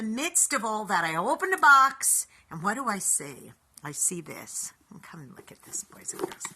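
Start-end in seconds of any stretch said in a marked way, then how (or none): 4.9-6.5 hushed secret whisper. Acted. Host showing viewers a special find, whispering. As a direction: draw them in close with a whisper.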